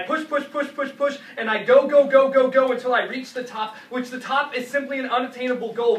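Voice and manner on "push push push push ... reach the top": rising, louder volley of repeated words; relentless driving build. Acted. Poet driving toward the top, relentless and building. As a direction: hammer each repeat, building to a peak.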